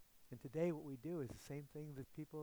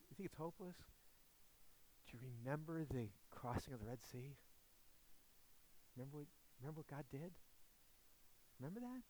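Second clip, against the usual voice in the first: gentle reminder; 12 dB quieter than usual. Acted. [name] gently reminds grumbling Steve.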